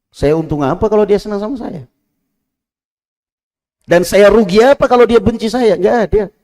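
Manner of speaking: high, loud exhortation; preaching at full force. Acted. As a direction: urge the faithful not to complain. Rhetorical preaching.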